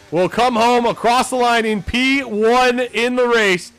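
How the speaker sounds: loud, slow, punchy results read; race announcer. Acted. Streamer announcing race results to viewers, broadcaster voice. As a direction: call the podium like a broadcaster.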